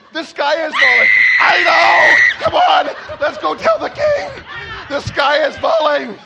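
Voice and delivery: high, full-volume hollering; rally the others in hammed-up alarm; frantic storybook shouting on the edge of laughter; mock-panic shouting. Climax of the acted bird panic.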